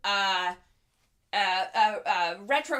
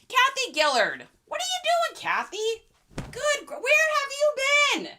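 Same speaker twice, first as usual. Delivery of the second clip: high, loud mock-scolding greeting; squealed where-have-you-been welcome; excited shout; 5 dB louder than usual. Real, not acted. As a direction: call out to a friend who has turned up, loud and high. Calling out to arriving viewer.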